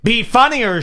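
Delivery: mock crowd chant of "Be funnier,"; crowd repeating. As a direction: shout "Be funnier," as the echoing crowd. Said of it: "eight people repeat it".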